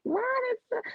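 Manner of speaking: high, soft startled what is that; sudden high surprise at the caller's number; startled question. Retold or imitated. Mother seeing the doctor's number, high surprised question. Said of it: "And I'm like".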